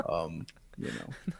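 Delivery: slow, quiet, flat robot voice. Pretend. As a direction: drone it out like a machine. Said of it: "I intentionally sound like a robot".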